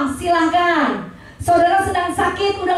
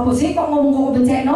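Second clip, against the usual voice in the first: husband puzzled; 3 semitones lower than usual. Retold or imitated. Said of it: "Dia bilang".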